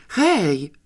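put-on boy calls family to see the dog; excited call. A narrator's reading. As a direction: call the family over, thrilled. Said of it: "zawołał z przejęciem".

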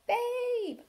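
high, long bleating cry; rapturous sheep bleat; great cry. A narrator's reading. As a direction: cry the name like a whole flock. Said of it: "burst out into a great cry".

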